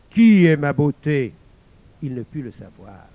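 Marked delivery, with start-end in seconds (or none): 0.1-1.3 loud, slow demon demand; growling demon. A narrator's reading. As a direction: roar the question slowly. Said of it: "Il gronda". (démon qui gronde, fort et lent)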